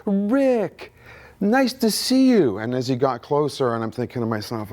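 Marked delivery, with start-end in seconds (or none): 0.1-2.6 imitated hearty yell, pitched way up; powerful yell. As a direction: call out the greeting high and slow, mimicking a powerful voice. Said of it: "he yells in a very powerful voice".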